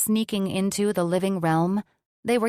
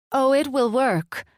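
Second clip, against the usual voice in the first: high, playful coy voice; 7 semitones higher, 5 dB louder than usual. A narrator's reading. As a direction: tease it out coyly. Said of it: "she coyly bit her lip".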